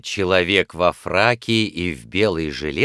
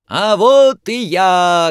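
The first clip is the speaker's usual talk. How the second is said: high, slow, loud shout; 9 semitones higher, 8 dB louder, slower than usual. A narrator's reading. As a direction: burst onto the stage shouting. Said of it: "громко крикнул хозяин". (громкий выкрик)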